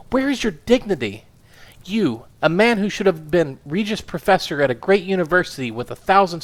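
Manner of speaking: a small furious woman tearing into her husband; indignant wife. Acted. Wife scolding her husband for his scandals, indignant.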